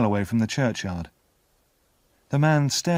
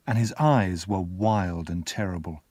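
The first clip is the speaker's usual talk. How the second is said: plain reading voice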